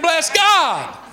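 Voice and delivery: ordinary talk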